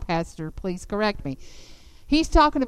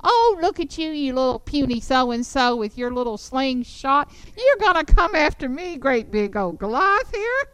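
put-on swagger down at the little boy; Goliath taunting; 4 semitones higher than usual. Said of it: "Do you know what it reminds me of? Goliath".

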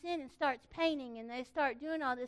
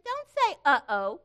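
exasperated shout; 3 semitones higher, 10 dB louder, slower than usual. Retold or imitated. Wife yelling at husband who has her keys, exasperated. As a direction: snap at him, loud and pitched way up.

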